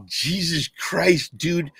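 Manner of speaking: slow, high stunned Jesus Christ; stunned disbelief. Retold or imitated. Reacting to his drunk friend, slow and high in disbelief. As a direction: drag it out in shock. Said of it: "And I was like".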